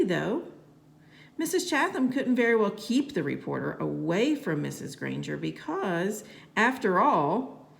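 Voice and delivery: ordinary delivery, nothing marked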